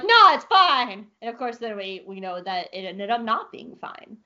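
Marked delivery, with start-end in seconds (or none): 0.1-1.1 higher, louder, drawn-out reassurance; breezy dismissal. Acted. Rebels brushing off Sibo's warning, breezy overconfidence. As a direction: wave the worry away loudly. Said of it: "they're like".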